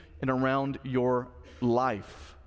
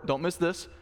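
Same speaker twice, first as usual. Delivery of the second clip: ordinary talk, nothing marked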